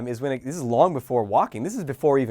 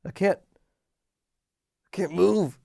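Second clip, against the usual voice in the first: put-on baby struggling; 6 semitones higher, 5 dB louder, slower than usual. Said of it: "they're just like".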